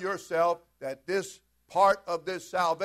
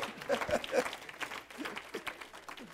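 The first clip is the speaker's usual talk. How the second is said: breathy laugh; 9 semitones higher, 7 dB quieter than usual. Real, not acted. Laughing after story.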